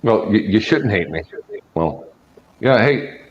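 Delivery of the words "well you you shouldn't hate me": ordinary talk, nothing marked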